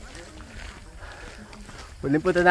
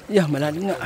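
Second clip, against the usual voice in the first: usual speaking voice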